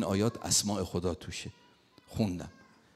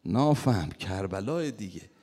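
put-on Mashhadi accent scolding; 3 semitones higher, 9 dB louder, slower than usual. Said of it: "باز با همون لهجهش گفت".

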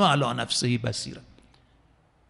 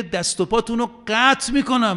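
raised, higher threat as Pharaoh; Pharaoh threatening; 8 semitones higher, 3 dB louder than usual. Retold or imitated. Preacher voicing Pharaoh's raised threat to the believers. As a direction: threaten them like a tyrant king. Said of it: "فرعون میگه".

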